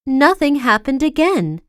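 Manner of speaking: plain reading voice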